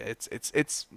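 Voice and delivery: halting false starts, lost for words; stammering. Real, not acted. YouTuber out of words over a tweet, stammering. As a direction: stammer through broken starts and pauses. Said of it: "I'm stammering".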